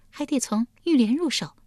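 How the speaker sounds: high, light feminine voice; scheming consort. A narrator's reading. Li Ji advising the duke where to begin, scheming. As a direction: propose it in a high lilt.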